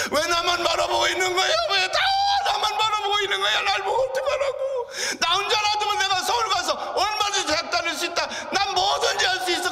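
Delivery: high, loud sobbing scream; screaming and crying. Retold or imitated. Son screaming under blanket about family burden, sobbing. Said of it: "소리를 지르기 시작을 했어".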